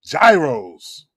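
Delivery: "Gyros" said as JAI-rohs; corrected pronunciation. Shown as a demonstration. Settling what you call it, firm JAI-rohs pronunciation. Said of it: "That's what you call it".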